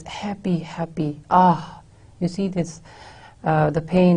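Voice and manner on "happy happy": breathy whisper, softer and falling away; whispered happy. Shown as a demonstration. Teacher showing Keats's longing to the class, in a whisper. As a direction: let the word happy fade into a pained whisper. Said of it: "he plays emphasis on these, on the word happy".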